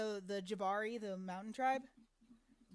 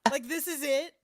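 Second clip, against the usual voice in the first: mocking; 5 semitones higher, 9 dB louder than usual. Real, not acted. Mocking hungry child.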